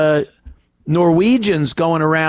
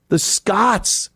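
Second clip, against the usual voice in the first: ordinary talk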